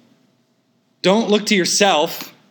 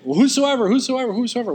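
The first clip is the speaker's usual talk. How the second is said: mocking whosoever; 8 semitones higher, faster than usual. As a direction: toss "whosoever" around like a vague slogan, high and airy. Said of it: "it's sort of just, like, out there".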